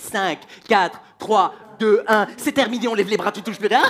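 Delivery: loud, commanding timekeeper; acted strict countdown; shouted countdown. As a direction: call time like a cooking-contest judge. Father counts down like show host.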